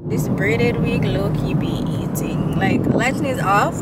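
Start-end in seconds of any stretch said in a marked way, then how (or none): 0.1-2.4 whispering. Real, not acted. Whispered aside about her wig.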